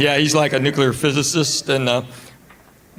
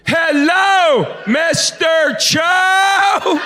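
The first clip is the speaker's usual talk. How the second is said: mother-in-law overdoing it; 12 semitones higher, much slower than usual. Retold or imitated. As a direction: shout it slowly as if to a foreigner. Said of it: "she thinks she's speaking Korean".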